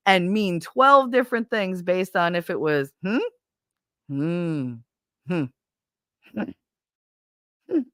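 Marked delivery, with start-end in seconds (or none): 3.0-7.8 series of short hums, each toned differently; varied-emotion hmms. Shown as a demonstration. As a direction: give every "hmm" its own meaning, starting with a question. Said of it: "those are all different friggin' emotions".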